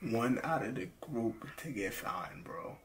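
hushed, unvoiced interrogation; whispering. Real, not acted. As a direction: whisper the threat close up. Interrogating a found player.

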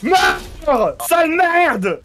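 yelled out in victory, pitch way up; triumphant shout. Real, not acted. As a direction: roar it after the kill. Kills enemy after tense fight. (cri de victoire)

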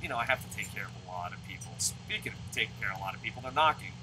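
usual speaking voice

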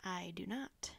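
low, near-whispered admission; hushed. A narrator's reading. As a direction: drop to a grave hush. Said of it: "in a hushed voice".

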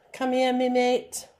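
a bad Australian accent slipping into English; attempted Australian accent. Shown as a demonstration. Teacher tries an Australian accent, sounds English. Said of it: "Sorry, that sounds English, not Australian".

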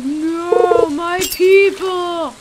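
slow, mournful otherworldly call; weird sky voice. Acted. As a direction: wail slowly like a spirit in the sky. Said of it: "weird thing talking to us".

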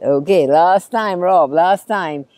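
ordinary delivery

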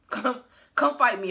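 ordinary delivery

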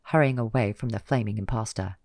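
ordinary delivery

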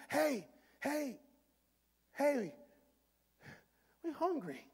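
lightly winded, high, spaced-out calls; mock panting between short calls; out of breath. Hungry disciples reaching Jesus after swimming, out of breath. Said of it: "they're out of breath 'cause they've been swimming".

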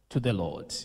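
usual speaking voice

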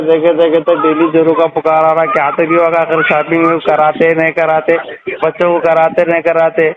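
ordinary talk

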